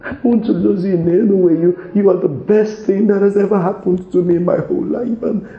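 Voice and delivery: loud, high anguished pleas; high loud sobbing-style pleading; loud desperate begging. Acted. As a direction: beg her at full voice not to leave. Husband desperately begs forgiveness.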